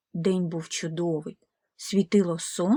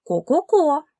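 a loud, higher cluck; louder hen clucks; 7 semitones higher, 5 dB louder than usual. Acted. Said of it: "закудахкали кури".

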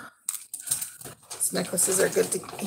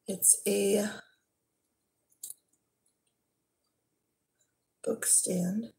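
whisper; 4 dB quieter, much slower than usual. Real, not acted. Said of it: "whispers while showing gift".

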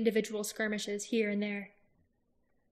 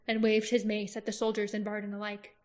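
plain reading voice

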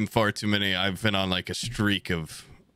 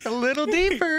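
very high falsetto coaxing; mock falsetto, beckoning someone further in; creepy luring voice. Seller coaxes buyer further down, creepy falsetto.

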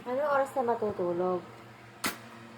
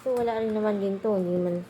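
ordinary delivery

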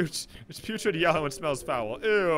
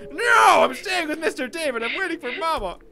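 put-on high little-boy protest, loud first word; defiant child; 12 semitones higher, 7 dB louder than usual. Fluffy refusing to leave Mister David, defiant child voice. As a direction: burst out like a stubborn kid.

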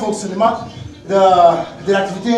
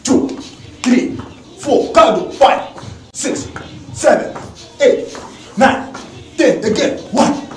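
fitness coach counting; 4 semitones higher, much slower than usual. Acted. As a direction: call out reps to the class.

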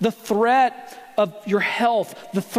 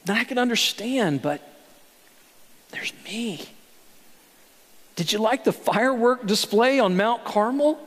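ordinary delivery

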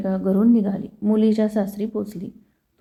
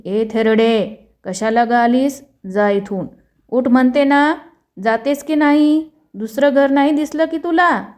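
angry yell, higher and louder; shouting queen; 4 semitones higher, 5 dB louder than usual. Acted. Queen yelling at the old woman to get out, angry and loud. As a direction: drive the beggar woman away with a shout. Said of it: "Rani ओरडली".

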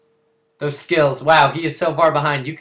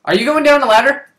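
calling out; 10 semitones higher, 8 dB louder, faster than usual. Real, not acted. Calling to teammate.

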